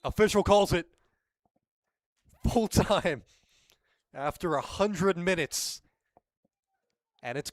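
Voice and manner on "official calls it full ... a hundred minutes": excited. Real, not acted. Full-time whistle call.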